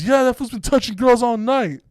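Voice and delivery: high-pitched heated comeback; imitated defiant accusation. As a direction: fire it back at a hostile group. Homie repeats accusation to friends, retold.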